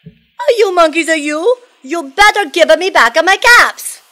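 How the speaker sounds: high, very loud storybook outburst; angry peddler. Acted. Peddler stomping his foot at monkeys, acted anger. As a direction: demand it with mounting comic temper. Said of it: "Now he felt quite angry".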